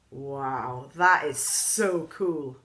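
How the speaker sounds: slow, drawn-out awe; amazed classmate. A narrator's reading. Classmate marvelling at the pizza, slow and awed. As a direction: stretch out the wow.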